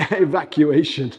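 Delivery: corrected word laughed out loud; laughing. Real, not acted. Host fixing the fire-notice slip, laughing.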